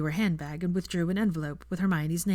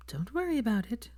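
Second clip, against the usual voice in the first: awkward; 6 semitones higher, 4 dB quieter than usual. Acted. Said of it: "said Hermione awkwardly".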